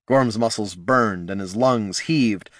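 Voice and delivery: ordinary delivery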